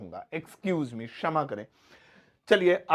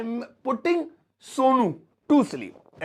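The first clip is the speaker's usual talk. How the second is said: drawn-out teaching example; slow demo sentence; 6 semitones higher, 4 dB louder, much slower than usual. Shown as a demonstration. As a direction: say it slowly, word by word. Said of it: "ऐसे".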